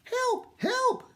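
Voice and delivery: high, mock-desperate call for help; a girl pretending to be a damsel, crying out. A narrator's reading. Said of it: "Minuet cried".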